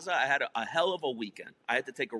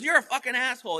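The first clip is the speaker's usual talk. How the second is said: mock angry ex-wife; 10 semitones higher, 6 dB louder, faster than usual. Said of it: "She goes".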